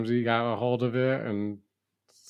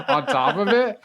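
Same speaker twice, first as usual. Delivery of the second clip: laughing; 12 semitones higher, 6 dB louder than usual. Real, not acted. Laughing about Uncle Roger's fake accent.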